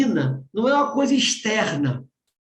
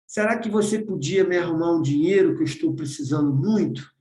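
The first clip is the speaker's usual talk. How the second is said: gentle reading; 5 semitones lower than usual. Retold or imitated. Wife reading daughter's letter gently, calm and meek. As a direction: read it meek and tranquil, like a sweet daughter. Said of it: "Ela tá falando aqui mansa, tranquila".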